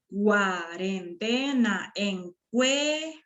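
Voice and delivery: slow, separated syllables; drawn-out teacher's syllabification. Shown as a demonstration. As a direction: sound out each syllable in turn. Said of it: "esas serían las sílabas".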